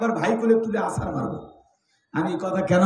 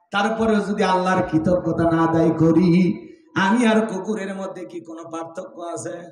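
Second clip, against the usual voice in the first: ordinary talk, nothing marked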